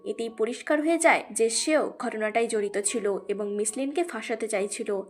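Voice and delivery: plain reading voice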